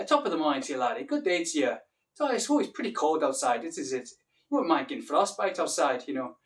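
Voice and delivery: lilting Irish accent; chatty Irish local, rising and falling. Shown as a demonstration. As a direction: put on a playful Irish accent. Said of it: "Irish accent as well".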